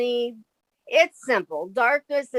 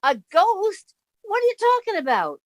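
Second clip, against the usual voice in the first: startled disbelief; 10 semitones higher than usual. Acted.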